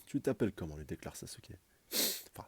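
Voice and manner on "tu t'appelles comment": usual speaking voice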